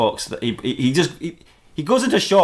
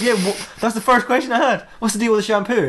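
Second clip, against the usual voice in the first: baffled questioning; 8 semitones higher than usual. Acted. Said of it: "And he goes".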